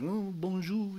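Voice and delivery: high, soft put-on French murmur; hammed-up French greeting; mock French accent. Visitor to France feeling like mocking locals, French accent. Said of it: "making fun of the French".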